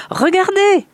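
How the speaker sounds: very high, loud call; thrilled father. Acted. Man calls to his family coming in, thrilled. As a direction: call everyone to look.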